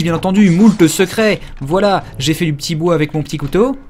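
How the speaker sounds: usual speaking voice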